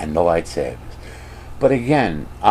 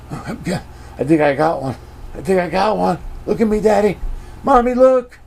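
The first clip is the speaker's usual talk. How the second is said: high mock child voice calling to parents; squeaky show-off kid impression; excited little kid; 11 semitones higher than usual. Mimics excited child on the ride.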